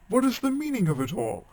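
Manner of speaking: high, loud indignant question; a mother demanding an explanation; grave demand. A narrator's reading. Mother demanding the godfather explain himself, high and loud. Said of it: "said very gravely".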